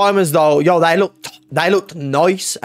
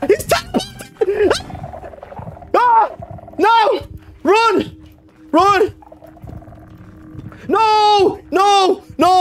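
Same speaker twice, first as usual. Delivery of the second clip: high shrieks into frantic pleading; real high-pitched gamer panic; panicked screaming. Real, not acted. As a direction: yelp in terror, then beg. Chased, begging not to die.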